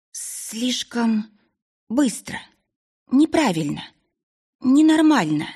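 doubts spilling out, building; anxious young woman. A narrator's reading. Girl voices her doubts. (взволнованный девичий голос)

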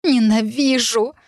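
drawn-out, strained hatred; hoarse cry. A narrator's reading. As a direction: drag the word out in hoarse rage. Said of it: "прохрипела".

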